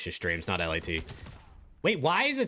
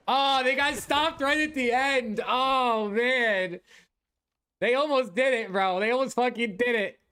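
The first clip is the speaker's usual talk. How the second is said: excited; 8 semitones higher, slower than usual. Real, not acted. Team almost scores, streamer reacts.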